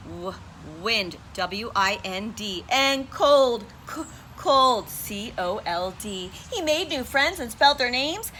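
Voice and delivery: plain reading voice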